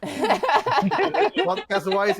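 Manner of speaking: laughing. Real, not acted. Laughing mid-remark.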